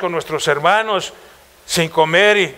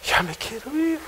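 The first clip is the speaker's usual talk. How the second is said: higher but much softer, tearful; whiny crying; 7 semitones higher, 8 dB quieter than usual. Retold or imitated. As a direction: whimper like a homesick child. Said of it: "Y lloraba". (lloriqueo agudo y suave)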